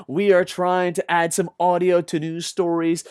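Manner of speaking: ordinary delivery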